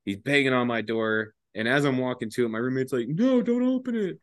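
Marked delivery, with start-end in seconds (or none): 3.1-4.2 imitated high-pitched warning; alarmed roommate. Roommate warns him in the story.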